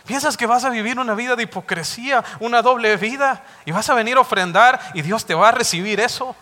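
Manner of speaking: God rebuking the unfaithful husband, thundering; God's rebuke. Acted. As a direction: scold the hypocrite with fire. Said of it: "lo mismo que le está diciendo".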